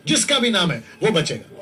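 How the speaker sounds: urgent, pleading sermon delivery; full-force preaching. Real, not acted. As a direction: proclaim it to the crowd, phrase by phrase.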